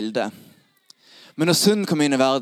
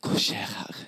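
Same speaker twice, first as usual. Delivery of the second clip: anxious inner thoughts; 3 semitones lower, 5 dB quieter than usual. Acted. Zacchaeus wondering if Jesus knows him, hushed and low. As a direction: think aloud softly, question by question. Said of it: "Zacchaeus tenker".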